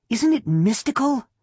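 put-on high little-girl voice full of wonder; breathless, awed little girl. A narrator's reading. Wife tagging after her husband in the cemetery, awed little-girl voice. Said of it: "breathlessly in her little girl's awed voice".

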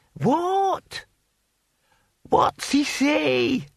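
put-on high, slow, cracked old man asking; deaf old man voice. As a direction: strain to hear, old and slow.

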